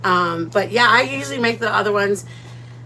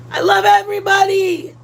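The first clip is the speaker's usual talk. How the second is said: higher, louder gushing declaration; big sweeping shout-out to everyone; 7 semitones higher, 5 dB louder than usual. Real, not acted. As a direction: throw love out to the whole chat. Gushing to chat, then correcting.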